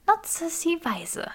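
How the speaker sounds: high, slow and cautioning; warning Granger. A narrator's reading. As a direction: Hermione warns him gently. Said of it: "warnte Granger".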